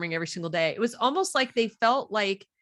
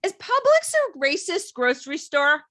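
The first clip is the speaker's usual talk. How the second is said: high-pitched imitation of a child asking; higher kid voice, questioning; daughter's question; 7 semitones higher, 4 dB louder than usual. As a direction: ask it as an earnest kid, pitched up. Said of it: "She goes".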